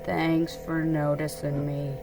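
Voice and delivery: low, slow and soft, a glum donkey; sighing low donkey voice; gloomy Eeyore. A narrator's reading. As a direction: drag it out, low and sad like Eeyore. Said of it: "said Eeyore".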